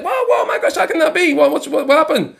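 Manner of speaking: high-pitched startled whoa; a man who just heard he'll die, jumping way up in pitch; shocked disbelief. Retold or imitated. Man hears birds foretell his death, high shocked cry. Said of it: "And the man's like".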